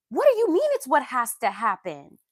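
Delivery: loud, incredulous outburst; outraged disbelief. Real, not acted. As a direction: throw the question back, loud and outraged. Outraged reply to commenters.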